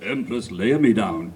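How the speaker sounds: low solemn voice. Acted. Spode's grave reply.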